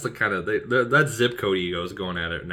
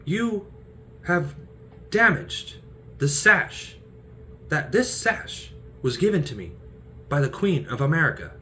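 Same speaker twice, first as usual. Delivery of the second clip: pompous Porthos; 9 semitones higher than usual. Retold or imitated. Porthos berating D'Artagnan over the sash, pompous. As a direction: puff up and complain grandly. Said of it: "He's like".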